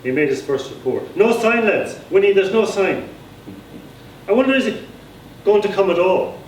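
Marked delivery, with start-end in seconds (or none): 1.2-3.1 high, loud eager call; a simple young man reports, excited and pitched high; excited stammering lookout. Acted. Johnny reports from the window. 4.3-6.4 high, loud eager call; a simple young man reports, excited and pitched high; excited stammering lookout. Acted. Johnny frets at window.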